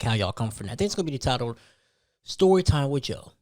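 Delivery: usual speaking voice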